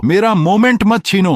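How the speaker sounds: very high, loud wail; shrieked protest. A narrator's reading. Streamer fighting to keep her moment, shrieking. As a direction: shriek it like a launched missile. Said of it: "चिल्लाया, जैसे कोई missile छोड़ रही हो".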